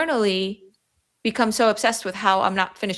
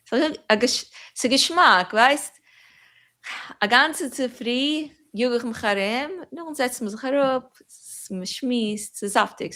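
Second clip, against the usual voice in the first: lingering Yiddish chat, mostly higher; Yiddish, savoured; 5 semitones higher than usual. Shown as a demonstration. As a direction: roll each Yiddish phrase like a tasty morsel. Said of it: "Can you say something in Yiddish for us".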